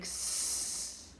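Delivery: "s" as a long "sssss" hiss, not the letter name; "s" as a sustained unvoiced hiss; snake-like S sound. Shown as a demonstration. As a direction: hiss "s" like a little snake between the teeth. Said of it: "la lettre qui fait ce bruit".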